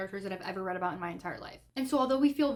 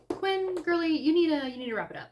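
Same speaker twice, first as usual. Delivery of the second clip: high, louder, fed-up call-out; exasperated scolding; 7 semitones higher, 5 dB louder than usual. Real, not acted. Reader scolding the character Quinn, exasperated high call-out. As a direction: tell her off like a fed-up friend.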